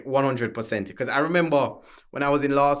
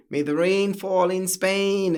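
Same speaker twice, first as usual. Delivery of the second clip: slow, proper American diction; mock the polished lawyer voice; posh American tone; 3 semitones higher, much slower than usual. Lawyers' polished tone mocked before the jury, slow and proper. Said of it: "you hear this tone".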